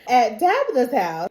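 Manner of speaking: usual speaking voice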